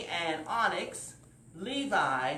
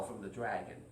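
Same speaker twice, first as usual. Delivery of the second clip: plain reading voice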